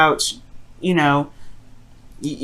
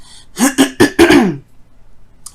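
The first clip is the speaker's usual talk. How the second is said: phlegmy throat clearing; 6 semitones higher, 8 dB louder than usual. Real, not acted. Throat clearing during reading.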